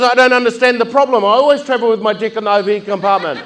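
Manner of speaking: high, loud mock innocence; innocent passenger.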